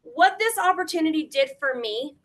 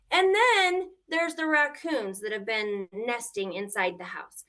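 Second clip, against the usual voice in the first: ordinary delivery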